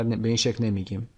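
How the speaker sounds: ordinary talk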